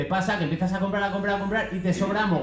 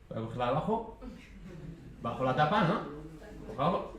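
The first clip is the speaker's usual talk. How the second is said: furtive muttered thought; 2 semitones lower, 9 dB quieter, faster than usual. Acted. Acts out furtive bread picker.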